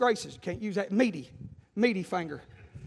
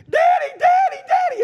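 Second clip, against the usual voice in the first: put-on very high, loud child's cry; screaming little boy; 9 dB louder than usual. Preacher as his son running to daddy, mock screaming. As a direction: imitate a panicked kid yelling for help. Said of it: "screaming, come running through the house".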